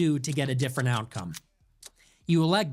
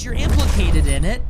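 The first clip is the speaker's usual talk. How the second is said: suddenly much louder, hurling the accusation; angry outburst; 9 dB louder than usual. Real, not acted. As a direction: spit the accusation out at full volume.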